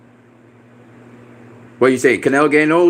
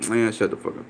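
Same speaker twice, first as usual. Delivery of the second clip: whispered shut-up, much softer; under-breath dismissal; 4 semitones lower, 7 dB quieter than usual. Real, not acted. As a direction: brush it off in a whisper. Dismissing a chat comment.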